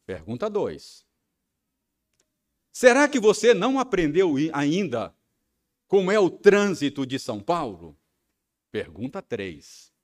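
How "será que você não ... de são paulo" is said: loud, high, mocking yell; husband shouting at his wife over the traffic; angry shout. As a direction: hurl the sarcastic question at her. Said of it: "Porque eu tava gritando".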